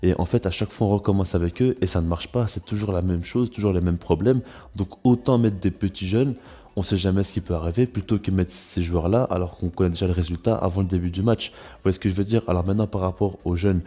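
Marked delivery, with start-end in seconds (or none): none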